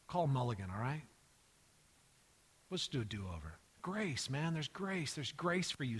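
soft, low, easygoing comfort; quiet, laid-back consoling; casual reassurance. Pretend. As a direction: let a pal off the hook gently. Imagined Jesus reassuring Peter casually.

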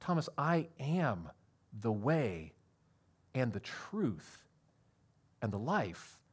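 slow, soft and tender, each phrase drawn out; gentle Jesus. Retold or imitated. Jesus answers Thomas, slowly and tenderly. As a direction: let each word land gently, as a promise. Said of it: "Jesus responded back to him and said".

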